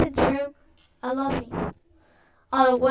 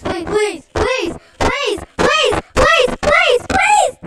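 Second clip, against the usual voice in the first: terrified horror victim; 3 semitones higher, 5 dB louder than usual. Pretend. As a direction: beg for your life, rising to screaming. Begging the seeker not to kill.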